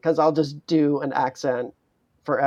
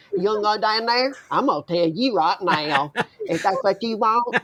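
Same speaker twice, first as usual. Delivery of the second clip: put-on swamp-rat Southern accent; 4 semitones higher than usual. Speaker showing how South Carolina sets them off, thick Southern accent. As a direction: lay on a backwoods Southern twang. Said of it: "I went to college in the South".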